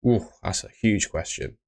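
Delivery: ordinary talk, nothing marked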